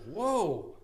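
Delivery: surprised cop. Retold or imitated. Cop seeing the zero reading, high mock surprise. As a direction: mimic an officer taken aback. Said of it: "the cop was like".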